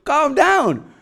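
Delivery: high, pleading exasperation; retold exasperation. Husband to his wife flipping out over a spider, exasperated.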